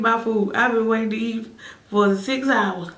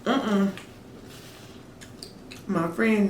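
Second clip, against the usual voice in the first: normal talking voice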